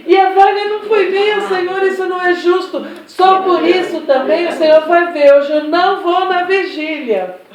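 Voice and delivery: hammed-up indignant protest, high and loud; revolted. Rejected candidate tells the Lord it's unjust, mock revolted. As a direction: imitate an outraged believer threatening a boycott. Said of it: "Revoltada, né?".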